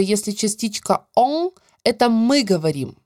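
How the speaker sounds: ordinary delivery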